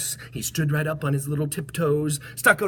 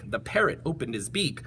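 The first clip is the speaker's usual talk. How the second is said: ordinary delivery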